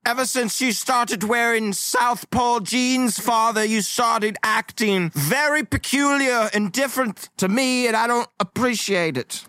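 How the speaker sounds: high-pitched, stilted formal complaint; mock-dramatic indignation, pitched high throughout; aggrieved son. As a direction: play a wounded son lodging a solemn grievance. Player voices aggrieved son.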